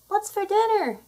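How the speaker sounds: high pitched polite ask; high cute ask. Acted. Beginner cat asking its human for food, high and cute. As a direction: sweetly beg for dinner.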